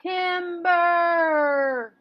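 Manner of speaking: long drawn-out high call. Acted. Lumberjack warns that the tree is falling, calling out.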